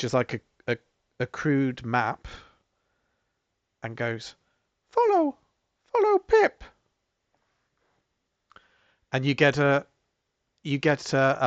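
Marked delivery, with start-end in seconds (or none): 4.9-6.6 very high, loud piping guide; bell-like voice. Acted. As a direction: urge them along in a tiny chiming voice. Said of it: "in a bell-like voice goes".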